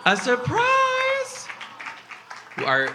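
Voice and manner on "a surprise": very high, stretched-out squeal of surprise; mock astonishment. Comedian teasing a couple about a surprise date, mock astonishment. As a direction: draw it out in a high, incredulous squeak.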